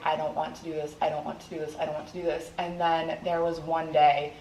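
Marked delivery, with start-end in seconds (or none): 0.0-2.5 tearful quote. Retold or imitated. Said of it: "and just cry and be like".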